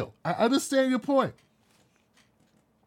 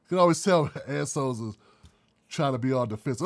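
ordinary talk

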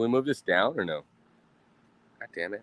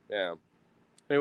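ordinary delivery

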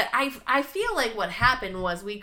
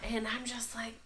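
hushed aside; whisper; 12 dB quieter than usual. Real, not acted. Whispering the lead-in to her friend. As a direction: drop to a whisper. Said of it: "unvoiced whisper".

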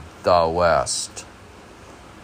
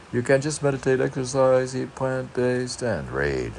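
reading aloud; 7 semitones higher than usual. Shown as a demonstration. Reading a healthy to-do list aloud, bright. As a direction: tick off each item brightly.

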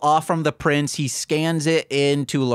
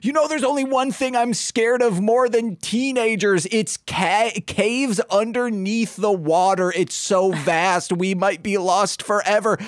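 high, whiny scared cartoon voice; scared Turbine; 6 semitones higher than usual. Turbine telling Lobo his fear of underwater caves, high and whiny. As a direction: fret like a nervous cartoon sidekick.

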